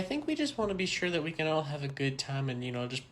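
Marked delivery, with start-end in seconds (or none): none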